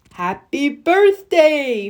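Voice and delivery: slow, put-on snowman voice, dropping at the end; a newly woken snowman greeting the children, slow. Snowman comes to life and greets the children, slow put-on voice. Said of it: "Frosty said again".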